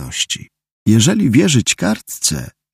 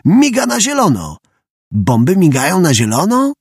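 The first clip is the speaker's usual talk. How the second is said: alarmed; 7 semitones higher than usual. Acted. Łysy panics over the blinking light.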